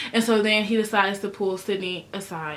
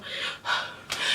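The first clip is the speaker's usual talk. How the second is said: dramatic sigh; 9 dB quieter than usual. Retold or imitated. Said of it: "she go".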